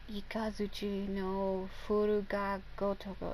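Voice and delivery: "Ikazuchi no Furuga Gotoko" whispered slowly, syllable by syllable; slow careful Japanese. Shown as a demonstration. Gamer sounding out a Japanese skill name, slow whisper. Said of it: "I have to say it super slow".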